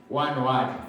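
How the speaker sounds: ordinary talk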